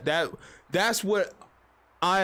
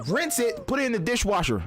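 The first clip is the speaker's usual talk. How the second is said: ordinary delivery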